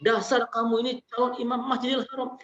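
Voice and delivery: mock angry scold. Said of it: "perkataan yang marah itu dirubah redaksinya menjadi sebuah doa".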